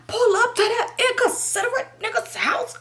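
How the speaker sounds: very high, indignant imitation; mock outrage. Boyfriend reacting to the invitation, mimicked outrage. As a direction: squawk it back in offended disbelief.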